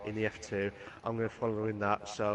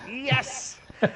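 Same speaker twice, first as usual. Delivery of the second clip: high, loud delighted "Yes!"; excited cheer; 6 dB louder than usual. Real, not acted. Co-host cheers the stay-classy sign-off, much higher and louder. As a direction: cheer at the punchline.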